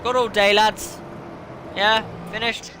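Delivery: sarcastic sing-song taunt. Real, not acted. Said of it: "sarcastic to slow AI".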